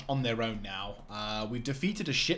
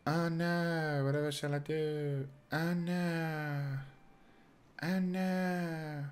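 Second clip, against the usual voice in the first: mock despair; 5 semitones higher, much slower than usual. Mock panic at enemy force.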